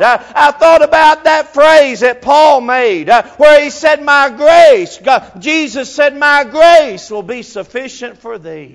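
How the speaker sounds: loud, high, ringing preacher's cadence; full-force preaching. Acted. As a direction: preach it at the top of your voice.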